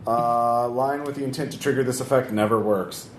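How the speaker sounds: ordinary delivery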